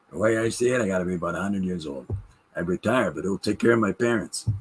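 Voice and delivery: George Burns impression. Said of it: "I put a pencil in my mouth".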